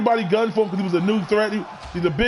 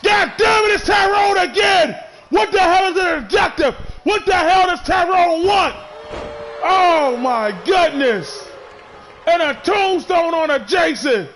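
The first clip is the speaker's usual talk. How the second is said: outraged yelling; 8 semitones higher than usual. Acted. Commentator yelling at interfering Tyrone, outraged shout. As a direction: blow up at the intruder.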